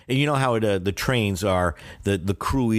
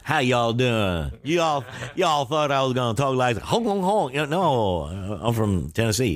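put-on a light Southern Tennessee accent greeting the crowd; 4 semitones higher than usual. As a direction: do the Korean-American comic's Tennessee twang as a casual imitation. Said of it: "that grew up in Tennessee".

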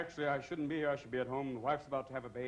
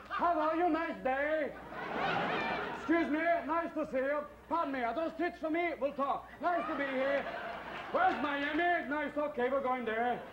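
put-on high, loud, chattery old man; little old man; 10 semitones higher, 3 dB louder than usual. Newborn as a little old man greeting everyone, high loud chatter.

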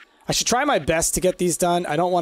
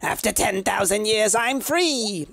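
Rita Repulsa voice; 5 semitones higher than usual. Shown as a demonstration. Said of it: "It's almost like a Rita".

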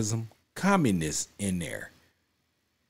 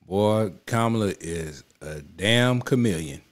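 normal talking voice

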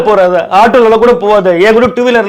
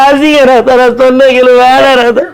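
higher, whining wail of self-pity; whiny self-pity; 5 semitones higher than usual. Acted. Husband wailing to his wife that he is ugly, in a whiny wail. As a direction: wail that everything about him is ugly.

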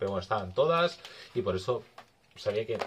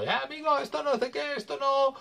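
much higher mock Maghrebi-accented seller; imitating Maghrebi accent; slower than usual. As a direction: play the street seller with a put-on Maghrebi accent, high-pitched. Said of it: "era magrebí".

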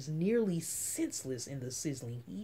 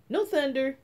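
eager reply; 8 semitones higher, 6 dB louder than usual. Acted. Eager child answering mother.

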